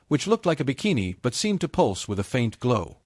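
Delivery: usual speaking voice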